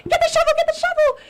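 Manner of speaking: shrill, rushed impression of a frightened mom; imitated panicked scream. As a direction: mock-scream for help, high and fast. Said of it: "She starts screaming for my dad".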